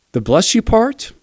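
high, slow incredulous question; mock baffled query. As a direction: ask in disbelief. Incredulous question in retold quarrel.

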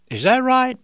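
feigned surprise in a fake voice; disguised voice. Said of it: "he continued to use the fake voice".

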